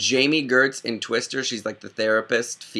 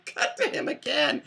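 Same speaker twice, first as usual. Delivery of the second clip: higher, airy voice through mock laughter; delighted audience member; 7 semitones higher than usual. Imitating the audience wanting the scene back, high mock-laughing voice. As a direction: imitate a moviegoer begging for more. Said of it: "reactions in the audience just being like".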